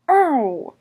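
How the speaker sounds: loud startled ow; exclaiming ow. A narrator's reading. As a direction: yelp when something hits you. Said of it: "he exclaimed in a muffled voice".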